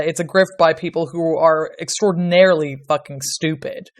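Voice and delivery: usual speaking voice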